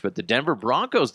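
normal talking voice

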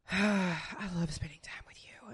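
tender whisper to her co-host after a sigh; whispered tenderness. Real, not acted. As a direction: breathe it gently to your partner.

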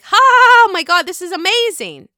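high, loud gasp of amazement; delighted amazement. Retold or imitated. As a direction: gush in thrilled disbelief, starting big. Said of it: "it's making me go like".

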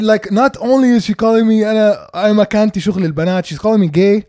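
normal talking voice

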